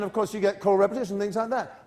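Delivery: normal talking voice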